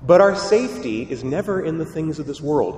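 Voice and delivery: normal talking voice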